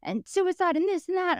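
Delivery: high, heated listing; hammed-up angry listing; mock outrage. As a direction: tick them off in fury. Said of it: "I was like".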